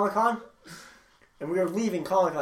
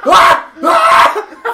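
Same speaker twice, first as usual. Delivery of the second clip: short, high, loud screams; imitated panicked shrieks; freaked-out scream; 12 semitones higher, 14 dB louder than usual. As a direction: yell out as if grabbed in a nightmare. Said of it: "I'm, like, freaking out".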